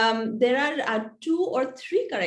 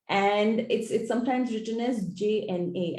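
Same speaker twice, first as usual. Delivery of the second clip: normal talking voice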